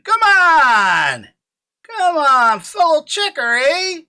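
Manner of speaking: high, loud hype, climbing; hyped call. Real, not acted. Streamer hyping his fellow checkers, high and loud. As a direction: pump up the crowd.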